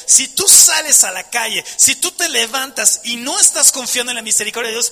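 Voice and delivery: normal talking voice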